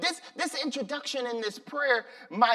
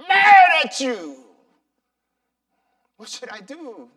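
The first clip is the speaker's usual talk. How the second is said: loud shouted anguish, higher; angry pleading prayer; 3 semitones higher, 10 dB louder than usual. Acted. Sonny crying out to the Lord, shouting then fading. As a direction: scream confusion at God, then ebb away. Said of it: "this is his prayer".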